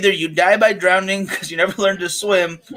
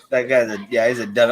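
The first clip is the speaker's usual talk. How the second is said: ordinary delivery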